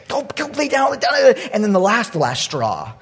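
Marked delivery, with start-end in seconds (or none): 0.1-1.3 high-pitched, hurried mock command; flustered scolding. Woken owner ordering the dog down, flustered. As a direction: shoo it off in exasperation.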